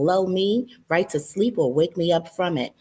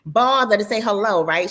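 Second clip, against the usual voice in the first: plain reading voice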